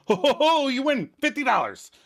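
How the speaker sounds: high, hammed-up congratulation; excited. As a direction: crow over someone's big win. Acted hyped-up fake win.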